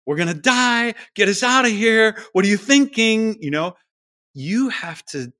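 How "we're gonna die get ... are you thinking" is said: high, loud panicked scream; loud mock panic, much higher; screaming cells. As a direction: shriek like your life depends on it. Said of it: "Every cell in your body is screaming".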